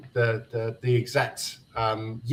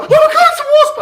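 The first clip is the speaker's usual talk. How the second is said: panicked shriek; 10 dB louder than usual. Retold or imitated. Girlfriend panics at wasp.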